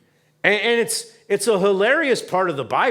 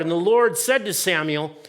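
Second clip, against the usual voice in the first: ordinary talk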